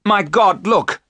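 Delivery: loud, high cry of horror; terrible cry. A narrator's reading. Jonathan spots the Count in London, horrified cry. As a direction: cry out in alarm, pitch leaping up. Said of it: "gave a terrible cry".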